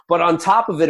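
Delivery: normal talking voice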